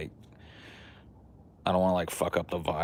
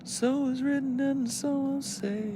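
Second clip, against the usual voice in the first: high solemn decree. Pretend.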